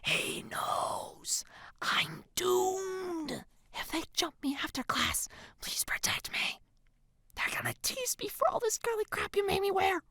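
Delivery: soft, breathy panicked whisper; hiss-free hushed whisper of dread. A narrator's reading. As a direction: whisper as if doomed. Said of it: "she whispers to me".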